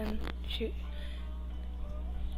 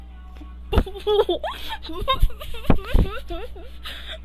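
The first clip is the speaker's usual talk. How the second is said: long, high, loud giggling fit; real laughing fit; 10 semitones higher, 6 dB louder than usual. Real, not acted. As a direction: crack up helplessly, high and loud. Cracks up after reading the quote.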